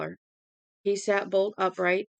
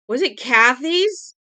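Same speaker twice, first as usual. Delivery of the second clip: higher, louder, slow frantic scream; 6 semitones higher, 10 dB louder, slower than usual. Acted. Mother hearing of the fire, screaming. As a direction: shriek the question in panic. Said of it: "Shelley Chatsworth screamed".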